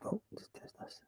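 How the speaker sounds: soft whispered aside; whispering. Real, not acted. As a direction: whisper it to yourself. Muttered aside during mic test.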